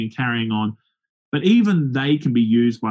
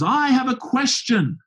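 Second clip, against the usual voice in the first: much higher and slow, a smug put-on heckler; mock heckler challenge; 12 semitones higher, slower than usual. Preacher mimicking the heckler's opening challenge, high and slow.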